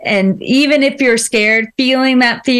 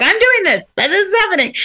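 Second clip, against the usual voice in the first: higher, mock headstrong child; determined kid; 7 semitones higher than usual. Young daughter announcing her plans, higher and determined. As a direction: stamp it out like a stubborn kid. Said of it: "You're like".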